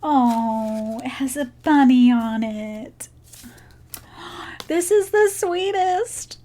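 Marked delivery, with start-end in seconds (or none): 4.7-6.4 high, gushing delight. Real, not acted. Vlogger cooing over a bunny gift, high and delighted. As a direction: coo over the sweet gift, pitched up.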